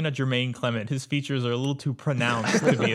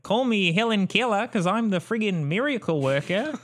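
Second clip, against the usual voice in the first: Ari Gold; 8 semitones higher than usual. Retold or imitated. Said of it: "he was Ari Gold".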